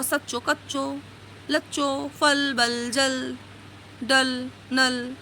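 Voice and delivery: each rhyme stretched out slowly; slow rhyming list. Shown as a demonstration. Teacher slowly dictating rhyming words to the class. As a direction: spell out every rhyme so children can copy.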